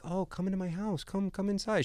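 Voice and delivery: put-on high, soft little old lady voice; sweet old lady. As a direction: lightly voice a kind old lady. Old lady voiced inviting them.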